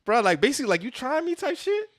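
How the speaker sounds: high mocking taunt, getting squeakier; mocking tough guy. Buzz taunting Woody, high mocking voice. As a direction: taunt him in a high sneering voice.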